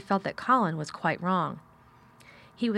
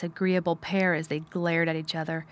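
ordinary delivery

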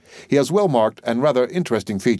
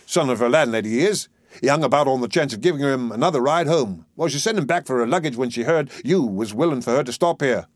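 higher, chatty Cockney dustman; explaining dustman; 6 semitones higher than usual. Acted. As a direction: tell the story as the dustman. Doolittle explaining.

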